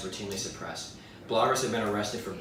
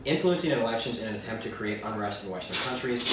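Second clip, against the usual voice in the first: ordinary talk, nothing marked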